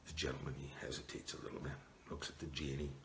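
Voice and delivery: ordinary delivery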